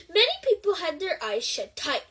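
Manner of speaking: usual speaking voice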